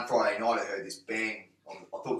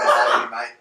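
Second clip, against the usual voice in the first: louder shout, laughing through it; called out; 13 dB louder, slower than usual. Retold or imitated. Chasing lads, he shouts to his friend, laughing. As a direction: call out to a mate mid-chase.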